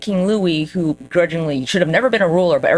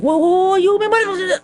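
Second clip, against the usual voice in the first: high, loud panicked whoa; mock panicked yelp; 4 dB louder than usual. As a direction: squeak out in mock panic as he's stabbed. Pompey being killed.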